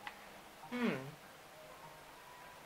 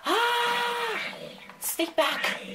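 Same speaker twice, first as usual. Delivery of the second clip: scared yell; 6 dB louder, much slower than usual. Acted. Mob approaches.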